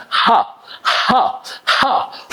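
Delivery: ha ha pushed from the belly; breathy h demo. Shown as a demonstration. Teacher demonstrating h for the class. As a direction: force air out on each ha. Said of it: "Can you do it three times".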